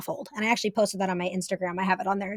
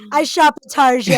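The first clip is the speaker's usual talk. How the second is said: loud, higher fake-French Tarjay; mock French; 4 semitones higher, 8 dB louder than usual. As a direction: play up Target as French "tar-zhay". Joking fake-French store name.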